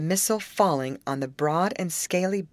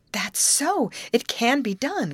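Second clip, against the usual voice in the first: high, eager exclamation; excited cry; 8 semitones higher, slower than usual. A narrator's reading. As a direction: cry out on seeing the way out. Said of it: "cried Betty".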